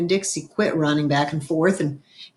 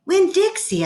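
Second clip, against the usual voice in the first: startled questioning; 11 semitones higher than usual. A narrator's reading. Waking child questions the frantic dog.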